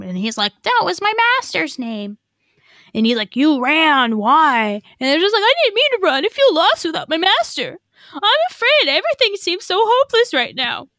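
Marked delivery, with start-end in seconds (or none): 0.6-5.4 lightly voiced wolf then a higher, whiny Ezra; mock the whiny young Jedi; Ezra impersonation. Podcaster voicing Ezra answering the wolf, high and whiny. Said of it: "My best Ezra impersonation". 5.4-10.9 put-on much higher whiny young Jedi voice; Ezra impersonation. Podcaster mocking Ezra's lost-apprentice lines, high and whiny. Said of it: "My best Ezra impersonation".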